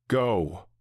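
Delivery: mild urgent yell to flee. A narrator's reading. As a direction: bark the order to run. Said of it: "Frank yells at us".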